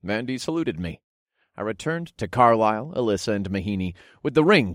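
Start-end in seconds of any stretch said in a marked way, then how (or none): none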